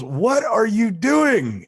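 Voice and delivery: high, loud, slow yell of disbelief; exasperated shout. Retold or imitated. Lawyer shouts at client mid-hearing, disbelieving yell. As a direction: drag it out in outrage. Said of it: "looks at me and goes".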